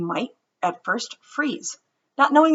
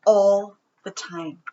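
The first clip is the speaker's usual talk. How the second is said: put-on gentle read-aloud storytelling for children; story reading. Teacher reading a story to her students, storybook voice. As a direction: read it to the class like a picture book.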